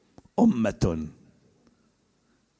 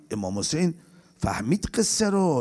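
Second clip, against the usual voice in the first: usual speaking voice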